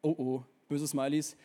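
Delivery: ordinary delivery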